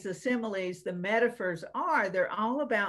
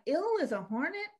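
puzzled question; 8 semitones higher than usual. Retold or imitated. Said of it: "and she's like".

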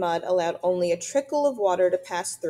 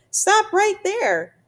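high, loud, drawn-out order to halt; urgent warning shout; 13 semitones higher, 7 dB louder, much slower than usual. A narrator's reading. Beaver warns the approaching robot to halt, urgent shout. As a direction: call out a sharp stop, stretching the words. Beaver's tail slap means stop.

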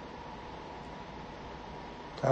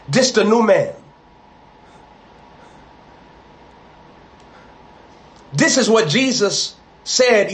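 higher, louder rising preaching cadence; building preacher voice; 8 semitones higher, 4 dB louder than usual. Acted. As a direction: preach it up toward the congregation.

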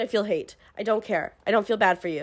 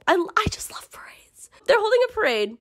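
high excited whisper; giddy whisper; 10 semitones higher than usual. Real, not acted. Reviewer whispering her glee about the parade to viewers.

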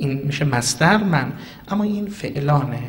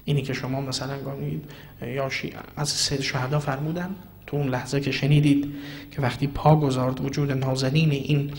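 ordinary delivery

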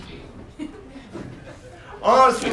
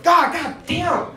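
startled; 9 semitones higher, slower than usual. Retold or imitated. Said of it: "I'm like".

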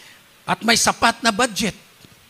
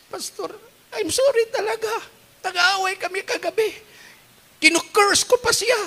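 higher, tearful confession; crying widow; 9 semitones higher than usual. Retold or imitated. A grieving widow confessing to her pastor, tearful and high. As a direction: sob out the apology, voice pitched up. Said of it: "Iyak siya ng iyak".

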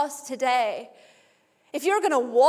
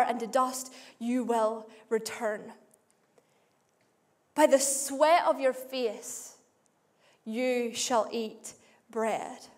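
solemn reading; 5 semitones lower, 5 dB quieter, slower than usual. Acted. Reading Genesis curse solemnly.

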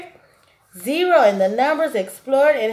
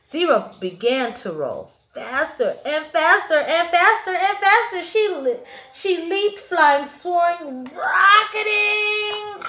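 building excitement; 8 semitones higher than usual. A narrator's reading. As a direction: pitch climbing higher and higher as she speeds up. Rolling faster, leaping.

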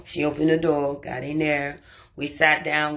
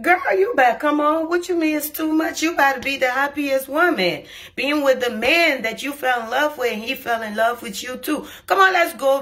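pushy urging; 7 semitones higher than usual. Retold or imitated. Urging her crying friend to come sit, high and pushy. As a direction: push her along, half teasing.

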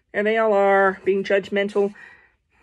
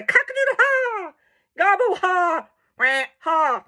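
high rooster crow turned into a laugh; hammed-up crow, gobble and quack, each ending in ha; laughing farm birds; 7 semitones higher than usual. Rooster, turkey and duck mocking, high hammed-up bird laughs. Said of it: "laughed and laughed".

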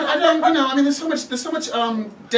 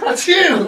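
sneezing; 10 semitones higher, 7 dB louder than usual. Acted. Fake sneeze to leave work.

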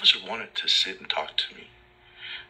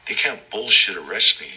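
ordinary talk

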